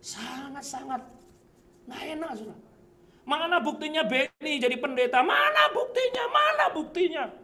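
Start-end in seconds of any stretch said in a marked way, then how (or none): 3.2-7.3 high, repeated taunt rising in pitch; jeering demand. Retold or imitated. Sceptics demanding proof from Benny the pastor, high jeering. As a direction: mimic scoffers, pushing the voice up. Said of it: "pernah dengar kata-kata seperti itu".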